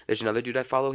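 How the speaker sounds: ordinary talk, nothing marked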